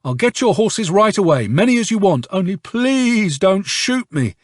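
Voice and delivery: put-on high, frightened pleading old man; begging shout. A narrator's reading. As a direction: grovel and beg for your life. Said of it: "starts shouting".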